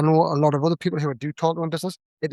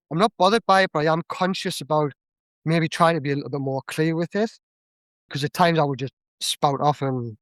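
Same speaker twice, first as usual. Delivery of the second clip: usual speaking voice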